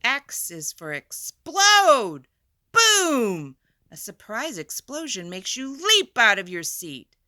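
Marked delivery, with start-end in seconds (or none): none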